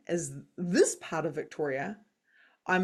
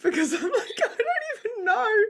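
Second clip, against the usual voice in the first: high, laughing through the words; real laughter; 4 dB louder than usual. Real, not acted. Telling about her atheist dad's reply, laughing hard. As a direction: laugh it out, half-helpless. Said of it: "I just kinda laughed".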